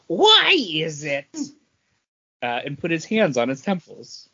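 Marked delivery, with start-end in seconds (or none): 0.1-1.3 slow, drawn-out mock question; imitating Paul. Imitating Paul's catchphrase, slow and drawn out. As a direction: stretch it out like Paul's old bit. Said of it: "Paul would always go".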